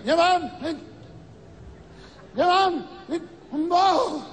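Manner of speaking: high, slow, drawn-out wail; mock wailing old woman. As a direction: ham up a widow sobbing at the wake. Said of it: "Lloraba la vieja".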